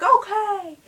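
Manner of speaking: high, loud quick "Okay!" as she retreats; scolded kid calling okay; startled okay. Retold or imitated. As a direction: yelp a hasty agreement. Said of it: "I was like".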